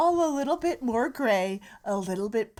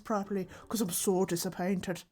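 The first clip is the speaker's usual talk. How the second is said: disappointed Irish; 4 dB quieter than usual. Acted. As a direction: speak Irish through a barely open mouth. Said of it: "Can't even form a consonants properly".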